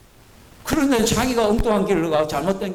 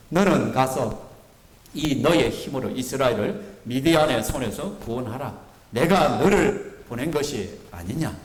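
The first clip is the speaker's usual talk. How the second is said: low, slow, solemn divine command; voice of the Lord; 7 semitones lower, slower than usual. Acted. The Lord commanding Gideon, low and solemn. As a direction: speak as God sending Gideon, grave and measured. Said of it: "여호와께서 그를 향하여 이르시되".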